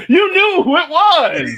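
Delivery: loud, high, drawn-out declaration; emphatic exclamation. Real, not acted. Proving the point to friends, loud and triumphant. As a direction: belt it out slowly as proof.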